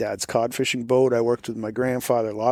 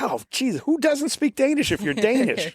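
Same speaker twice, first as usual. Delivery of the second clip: high-pitched, incredulous retort; retold exasperated astonishment, pitched way up; mock disbelief; 12 semitones higher than usual. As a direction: exclaim in playful disbelief, voice shooting up. Retelling his disbelief at non-speaker.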